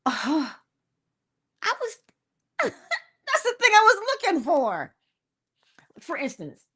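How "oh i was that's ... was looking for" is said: high, hammed-up delight with a laugh; delighted pearl-clutching surprise. Someone acting out the thing showing up, delighted surprise. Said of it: "you clutch your pearls and you go".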